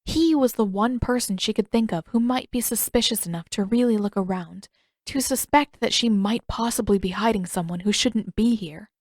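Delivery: ordinary delivery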